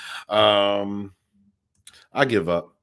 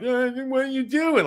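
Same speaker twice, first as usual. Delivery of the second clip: much higher mock whine; 3 dB louder than usual. Mocking how a friend whines at him, a high mock whine. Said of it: "one of those whines where it's like".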